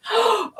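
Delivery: sudden high gasp of dismay; surprised gasp. Real, not acted. Vlogger breaking her teacher sign, gasping. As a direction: gasp as something snaps.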